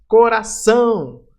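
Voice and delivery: "Coração" slow, high and loud, long final ÇÃO; stress on ÇÃO. Shown as a demonstration. Teacher showing the strongest syllable, stress on ÇÃO in "Coração". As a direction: stretch "Coração" out, pushing the last syllable. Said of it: "a sílaba mais forte".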